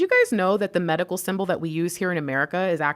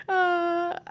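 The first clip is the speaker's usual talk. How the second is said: high laugh; 13 semitones higher than usual. Real, not acted. Laughs at own intro voice.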